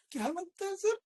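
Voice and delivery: mock caller begging for a tip, pitch up on sir. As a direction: ape a needy caller. Mimics caller asking opinion.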